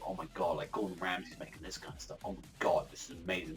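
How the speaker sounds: soft breathy amazement; hushed awe. Retold or imitated. TV viewer marvelling at fancy food, hushed. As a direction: whisper like a dazzled fan. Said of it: "and it's like".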